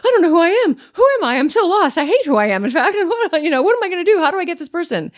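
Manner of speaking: high, loud, frantic mock despair; imitated inner panic. Voicing the hollow guy's searching eyes, high mock panic. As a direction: send up a lost soul panicking inside. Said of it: "you can tell they're like".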